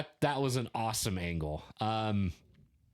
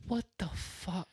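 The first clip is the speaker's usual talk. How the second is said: shocked disbelief; 8 semitones higher, 5 dB quieter than usual. Real, not acted. Reacts to the voice modulator.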